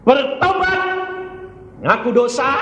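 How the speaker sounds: high, loud pulpit command; forceful exhortation. Real, not acted. Preacher commands sinners to repent, high and loud. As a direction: call it out with full force.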